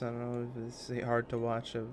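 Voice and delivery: mock mumbly let's-play voice. Shown as a demonstration. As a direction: mumble it into your chest to show the old habit. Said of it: "when I talk like this".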